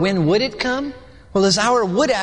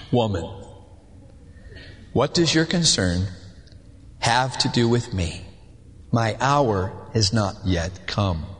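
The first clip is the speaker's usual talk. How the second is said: stern Jesus; 9 semitones lower, 4 dB quieter than usual. Acted.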